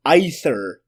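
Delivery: "either" said as EYE-thur; long-i pronunciation. Shown as a demonstration. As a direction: start "either" with an eye sound. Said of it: "which is pronounced".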